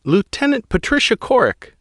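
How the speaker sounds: high female pilot voice, tense and pleading; a rattled pilot explaining to an admiral; young woman pilot. Acted. Pilot Guano's voice.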